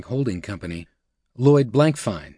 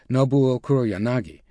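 plain reading voice

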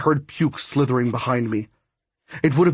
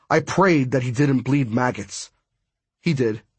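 plain reading voice

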